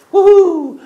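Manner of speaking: high, loud joyful whoop; woohoo cheer. Retold or imitated. Nintendo cheering on hearing the tape. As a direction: whoop it out in delight. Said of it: "and I quote".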